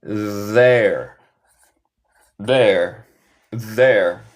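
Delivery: THERE as one syllable, repeated with pauses; contracted pronunciation. Shown as a demonstration. Teacher has students repeat the contraction, clearly modelled. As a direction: model "There" as one blended word, falling. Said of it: "se pronuncia there".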